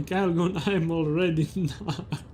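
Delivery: words broken up by laughter; laughing. Real, not acted. Streamer repeating a line for viewers, laughing.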